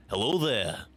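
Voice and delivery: lightly voiced old Obi-Wan; a hint of the old Jedi hermit; original trilogy Obi-Wan. Shown as a demonstration. Quizzing a friend, old Obi-Wan voice. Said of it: "can you tell which Obi-Wan".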